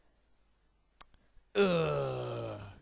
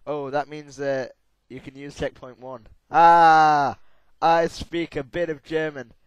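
ordinary talk, nothing marked

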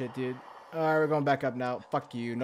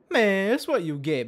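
gloating taunt, higher and louder; 5 semitones higher, 4 dB louder than usual. Real, not acted. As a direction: crow it over the defeated fish. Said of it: "repeat louder, higher".

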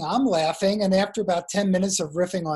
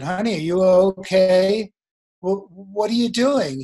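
louder, slow worried honey are you okay; concerned question; 2 semitones higher, 5 dB louder than usual. Retold or imitated. Husband asks wife lying face down, worried and louder. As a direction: check on her with retold alarm.

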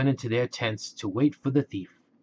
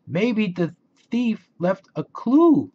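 Velma, thoughtful; 9 semitones higher, 5 dB louder, slower than usual. Acted. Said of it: "said Velma".

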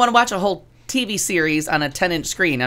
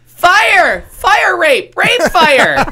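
high, full-voiced shout for help, hammed up; imitated yelling; 13 semitones higher, 8 dB louder, much slower than usual. Retelling a trapped character's cries on a show, imitated yelling. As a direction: belt it out like a panicked sitcom character.

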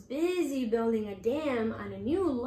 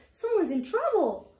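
urgent; 7 semitones higher, 5 dB louder than usual. Acted. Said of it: "Deer called".

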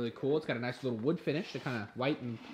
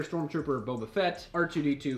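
usual speaking voice

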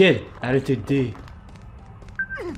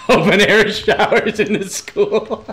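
laughing; 7 semitones higher, 4 dB louder, slower than usual. Real, not acted. Gamer finding open air school showers, laughing out the words. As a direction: laugh through the words, slow and high.

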